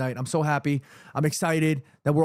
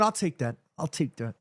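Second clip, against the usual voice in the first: normal talking voice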